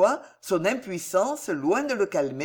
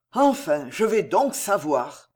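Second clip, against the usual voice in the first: plain reading voice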